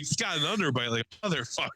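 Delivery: fast, laughing quote. Retold or imitated. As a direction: rush it out laughing. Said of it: "He's like".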